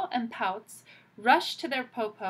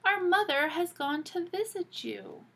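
put-on higher, bright child voice; 9 semitones higher, 4 dB louder than usual. Eldest girl telling the visitor, lightly voiced child. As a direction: tell granny innocently. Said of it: "Shang said".

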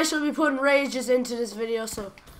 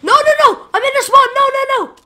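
high, loud screaming no; shriek of disbelief; 12 semitones higher, 10 dB louder, faster than usual. Real, not acted. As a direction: scream at the missed shots. Missed shots, screaming in disbelief.

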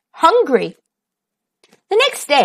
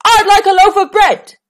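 loud, higher booming voice; 9 semitones higher, 6 dB louder than usual. Acted. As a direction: boom it across the shop. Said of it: "he boomed".

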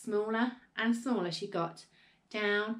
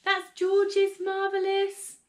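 high, loud, drawn-out warning; slow panicked cry, cut short; alarmed cry; 11 semitones higher, 6 dB louder, much slower than usual. A narrator's reading. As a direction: wail the warning, stretching the words. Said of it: "cried Missus Cranky".

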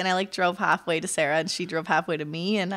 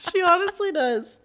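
laughing; 8 semitones higher than usual. Real, not acted. Repeats while laughing.

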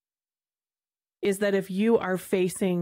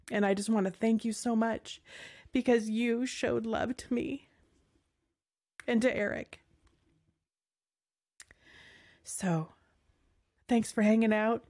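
softer, slightly raised voice, close to crying; tearful gratitude; 3 semitones higher, 4 dB quieter than usual. Real, not acted. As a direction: thank them through welling tears. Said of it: "making me cry".

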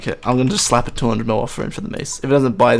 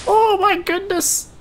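very high, slow, stretched "oh my goodness"; amazed exclamation; slower than usual. Real, not acted. Gamer seeing the boss HP drop fast, very high slow awe. Big damage chunk on boss.

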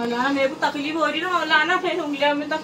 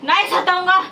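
loud forced promise; real loud, pressed reply; louder on demand; 3 semitones higher, 5 dB louder than usual. Real, not acted. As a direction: raise your voice to obey the order. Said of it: "जोर से।".

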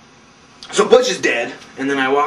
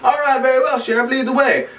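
higher put-on obliging voice; mock compliant schemer; 8 semitones higher than usual. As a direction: yield with a show of politeness. Burke gives in to sheriff.

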